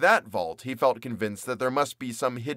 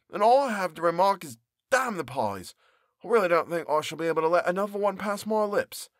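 higher, exasperated character voice; fed-up baker; 6 semitones higher than usual. Acted. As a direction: push the pie away in disgust. Character swears off pies.

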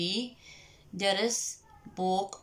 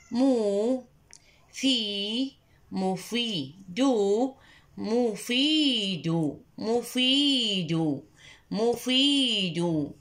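slow مفيد with stretched syllables; slow classroom chant; slow drilled repetition; much slower than usual. Shown as a demonstration. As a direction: drill مفيد slowly for children. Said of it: "لنردد معا".

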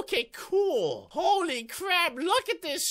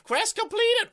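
announcer shout; 7 semitones higher than usual. Real, not acted. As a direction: call it out like a game announcer. Announcing quest done.

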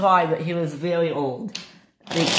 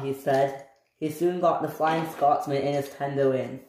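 ordinary delivery

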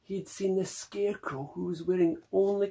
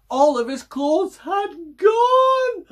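dramatic cry; 13 semitones higher, 8 dB louder, slower than usual. A narrator's reading. Dramatic story moment.

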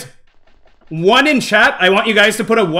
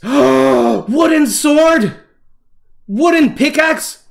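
high, drawn-out delighted exclamation; gasp, then stretch it out in awe; thrilled and high-pitched; excited discovery; 6 semitones higher, much slower than usual. Real, not acted. Gasping at new crafting discovery.

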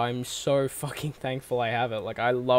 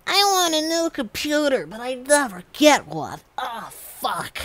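put-on high, whiny spoiled-kid whinge; mock whiny tantrum; 10 semitones higher, 3 dB louder than usual. Mocking himself whinging to mum for a new computer. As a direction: sulk like a spoiled child demanding a toy. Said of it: "chucked hissy fits and kicked things".

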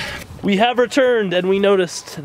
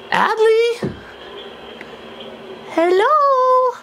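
calling out; much slower than usual. Real, not acted. Calling out for his daughter.

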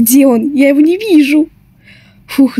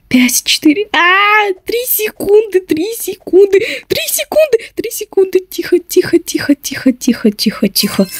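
tense hush; 7 semitones higher, 3 dB quieter than usual. Real, not acted. Final seconds, hushed tension.

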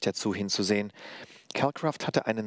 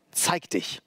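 raised-pitch angry command; growled demand; 8 semitones higher than usual. A narrator's reading. Executioner ordering the hidden woman out, angry command. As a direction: demand it sharply, pitch jumping up. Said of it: "fragte er grollend".

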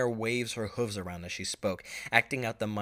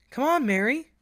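high, louder filly voice beckoning; calling sister; 8 dB louder than usual. Acted. Busy Bee inviting her little sister to watch, high calling voice. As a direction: call her over brightly and high.

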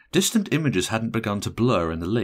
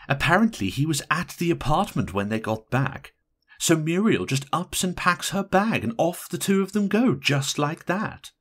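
higher, animated gossipy telling; matron of honour; 10 semitones higher than usual. A narrator's reading. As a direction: tell the news in a raised, pointed voice. Matron of honour relates the elopement.

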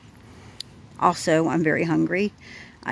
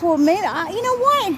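agitated inner voice; 10 semitones higher, 3 dB louder than usual. Retold or imitated. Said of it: "thinking to myself".

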